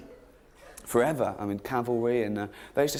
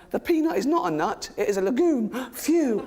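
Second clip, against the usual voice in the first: higher, proud mock reading of a fact; mock trivia poster; 11 semitones higher, 4 dB louder than usual. Quoting a trivia website fact, mock-proud higher voice. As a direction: deliver it like a smug relieved nerd. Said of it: "this is a real one".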